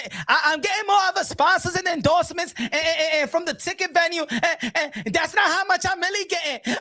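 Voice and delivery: high, stammering mock protest; mock plea-copping. Host mocking a boxer backtracking on her pay, flustered whiny imitation. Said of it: "Now you cop and plea?".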